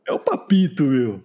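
excited. Real, not acted. Excited reveal of listener name.

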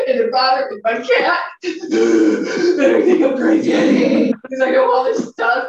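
hammed-up tearful panic; retold frantic, sobbing phone call; mock crying. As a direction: wail in exaggerated distress, high and loud. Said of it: "calling her and crying".

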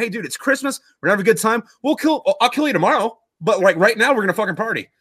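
high, upbeat buddy voice; chummy truce pitch. Retold or imitated. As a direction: act out cheerfully agreeing to party. Enemies agree to party.

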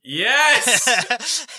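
loud, high-pitched delighted yell; excited cheer. Real, not acted. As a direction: burst out cheering before laughing. Delighted reaction to player's joke.

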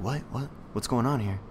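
hushed, low imitation of bewilderment; soft, baffled asking around; confused crowd. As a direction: murmur it like a puzzled audience. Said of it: "everyone was like".

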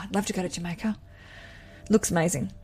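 normal talking voice

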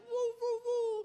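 high, whooping excited pug; excited dog. Acted. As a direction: whoop like a dog that hears you come home. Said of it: "you would just see the excitement".

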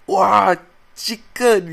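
high, loud catching himself mid-curse; mock near-curse. Stopping a Chinese curse, loud and high. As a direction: blurt it loud like a swear. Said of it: "I wanted to scold that Chinese bad word".

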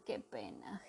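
whispered qué pena, soft and unvoiced; moaning whisper. Maldeocus moaning in a whisper over the lost one. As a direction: breathe qué pena as a sorry moan. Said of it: "gemió Maldeocus".

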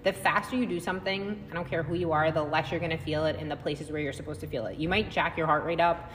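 usual speaking voice

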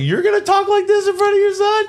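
very high, squeaky mock disbelief; incredulous echo. Storyteller answering the father, incredulous. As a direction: throw the challenge back way up high. Echoes man's challenge back, incredulous.

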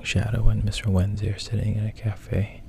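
ordinary delivery

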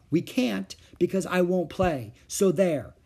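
high, emphatic refusal with a final so there; stubborn refusal. A narrator's reading. Older sister refusing her pestering little sister, emphatic. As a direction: put your foot down. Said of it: "answered Beezus".

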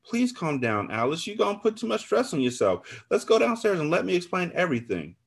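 high-pitched anxious plea; pleading. A narrator's reading. Narrator begs friend to calm down, pleading. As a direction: appeal to her without raising the volume.

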